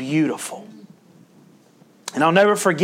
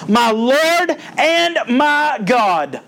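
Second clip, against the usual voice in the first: slow, drawn-out awe; awed; 5 semitones higher, much slower than usual. Acted. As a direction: breathe it out slowly in wonder. Thomas's awed response.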